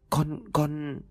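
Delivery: high, halting, timid stammer; trembling hesitation. A narrator's reading. Maid ordered outside into the dark, hesitating fearfully. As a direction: falter in a small high voice. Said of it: "Con Mận liền run rẩy".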